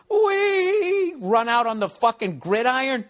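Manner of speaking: high, squealing mock whee; sarcastic squeal of joy; mock gleeful fan. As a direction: ham up a prancing fan. Mocking fans running onto field.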